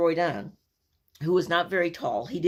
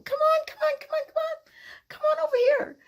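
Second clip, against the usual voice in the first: very high sing-song coaxing; coaxing calf. Retold or imitated. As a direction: lure the calf closer with a high call.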